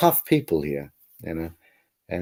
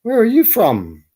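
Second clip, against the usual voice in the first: higher and louder, slowly asking the question; curious local asking; 10 semitones higher, 5 dB louder than usual. Retold or imitated. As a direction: lightly play a nosy shopkeeper. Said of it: "people would say".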